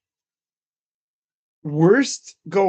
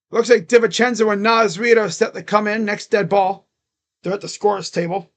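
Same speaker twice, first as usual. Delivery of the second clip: play-by-play; 4 semitones higher, 7 dB louder than usual. Acted. Live play-by-play.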